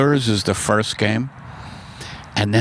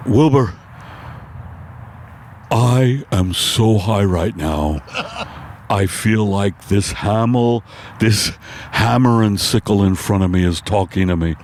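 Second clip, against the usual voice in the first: Mister Ed tripping; 3 semitones lower than usual. Acted. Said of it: "my impersonation of Mister Ed, on acid".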